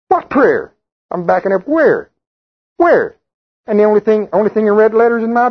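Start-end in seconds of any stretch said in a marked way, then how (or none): 0.1-3.2 rising, incredulous questions; playfully bewildered what prayer; mock puzzled. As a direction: act baffled, climbing higher.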